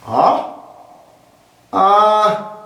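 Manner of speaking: rising Ah full of doubt; Ah like you don't buy it. Shown as a demonstration. Teacher demonstrating doubt, sceptical Ah. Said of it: "Anzweifeln des Gesagten".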